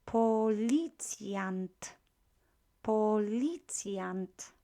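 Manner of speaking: Policjant very slow; slow model word. Shown as a demonstration. Teacher modelling Policjant for class. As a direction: sound out Policjant. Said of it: "teacher models word".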